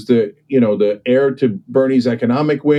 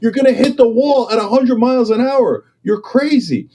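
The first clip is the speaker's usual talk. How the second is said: a capitalist yelling at business leaders to turn; urgent warning yell; 9 semitones higher than usual. Retold or imitated. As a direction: shout at them to veer before the crash. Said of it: "I'm a capitalist going".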